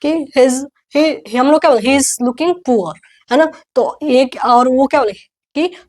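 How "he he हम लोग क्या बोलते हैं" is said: ordinary talk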